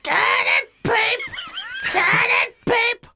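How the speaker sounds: loud, high, drawn-out pigeon screech; unpleasant pigeon coo. Shown as a demonstration. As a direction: coo it slowly at full blast. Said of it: "It'll be unpleasant".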